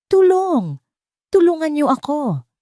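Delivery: high, loud desperate cry for help; woman screaming for help. A narrator's reading. (sigaw ng babaeng humihingi ng tulong)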